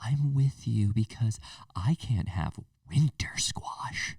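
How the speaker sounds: slow, breathy mock-tragic confession; mock whisper. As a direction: whisper it slowly like a sad secret. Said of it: "She's like".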